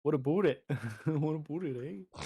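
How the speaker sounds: hammed-up Canadian accent. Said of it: "They're like".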